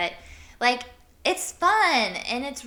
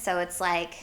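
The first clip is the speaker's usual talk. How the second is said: ordinary talk, nothing marked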